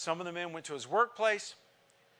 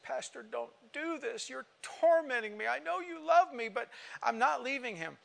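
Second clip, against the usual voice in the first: crying plea; 9 semitones higher than usual. Retold or imitated. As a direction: sob out the refusal in a high voice. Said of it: "And she just cried".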